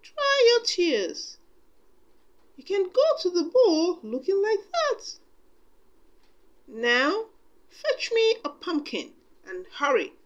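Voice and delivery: put-on soothing old godmother, then brisk orders; fairy godmother comforting and bustling. A narrator's reading.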